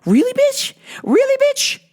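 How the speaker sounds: high incredulous mock retort; sassy disbelief. Speaker confronting coworker, high sassy disbelief. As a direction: throw it back with full attitude. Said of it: "And I was like".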